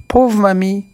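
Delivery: put-on high, childlike cry of pity; little boy crying out. A narrator's reading. Petit Pierre to the flattened witch, high childlike cry. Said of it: "Petit Pierre s'écrie".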